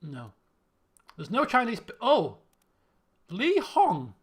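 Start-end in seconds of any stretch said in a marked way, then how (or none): 2.0-4.1 louder, higher burst of recognition; sudden realisation. Real, not acted. Player suddenly remembers the missing Chinese crewman, surprised realisation. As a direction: blurt it out as it dawns on you.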